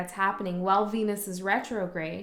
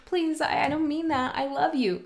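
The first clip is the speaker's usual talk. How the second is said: higher, pleading and apologetic; higher, anxious make-nice voice; pleading placation; 8 semitones higher than usual. Retold or imitated. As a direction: beg them not to be hurt, almost gushing. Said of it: "See, I'm, I'm doing it right now with you".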